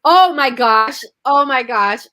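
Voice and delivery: loud, high burst of amazement; shocked exclamation. Real, not acted. Host hearing a new parenting phrase, loud amazed exclamation. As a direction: gasp it out in real surprise.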